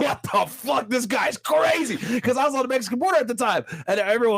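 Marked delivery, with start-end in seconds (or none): 0.0-2.0 higher, slow mock outburst; half-laughing replay of his own shock; drawn-out "what the fuck"; retold disbelief. Retold shocked reaction to Trump.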